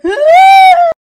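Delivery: very high, loud shriek; hammed-up piercing cry; mock wail. Husband still wailing as doctor intervenes, high mock shriek. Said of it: "still he's like".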